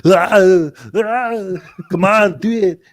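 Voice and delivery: groan, then egg them on in an Austrian accent; hammed-up strongman bellow; Arnold Schwarzenegger. Shown as a demonstration. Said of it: "doing an Arnold Schwarzenegger impersonation".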